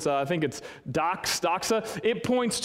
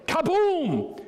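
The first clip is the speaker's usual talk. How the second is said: mock explosion; 11 semitones higher than usual. Pastor joking about angels, cartoon kaboom shout.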